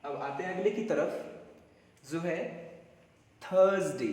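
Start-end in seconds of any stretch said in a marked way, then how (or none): 3.4-4.1 थर्सडे, r almost silent; Thursday. Shown as a demonstration. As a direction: model थर्सडे as thurz-day. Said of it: "क्या पढ़ा जाता है इसको?".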